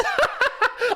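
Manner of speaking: sudden high-pitched laugh; real amused laugh; high burst of laughter. Real, not acted. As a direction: let a squeaky laugh escape. Laughs at the song title.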